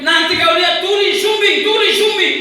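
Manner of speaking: high, loud chanted taunt; devil's screaming. Retold or imitated. Devil shouting threats in father's ear, screaming taunt. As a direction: yell the threats like a jeering devil. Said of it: "Sementara iblis teriak".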